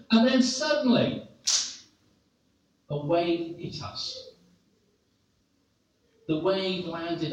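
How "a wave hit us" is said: usual speaking voice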